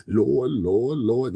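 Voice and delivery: mock old man. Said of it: "I can't do Steven voice".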